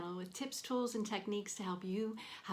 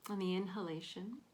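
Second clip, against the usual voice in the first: guided meditation. Real, not acted. Counselor leading listeners through a body scan, calm guided meditation. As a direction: guide them gently through breath and body, leaving space between phrases.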